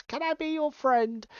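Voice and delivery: lightly voiced imitation of an online flirt; mock scammer. Host imitating a social media scammer, mock flirty come-on. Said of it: "twenty-something Eastern European".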